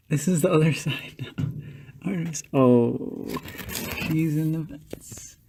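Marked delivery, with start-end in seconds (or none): 4.1-5.4 whisper. Real, not acted. Hushed report on the cat.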